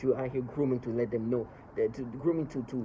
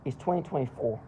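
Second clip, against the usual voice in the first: normal talking voice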